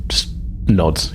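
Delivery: ordinary delivery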